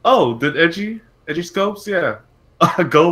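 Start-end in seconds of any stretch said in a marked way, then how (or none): none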